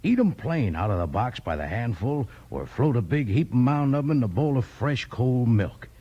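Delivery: normal talking voice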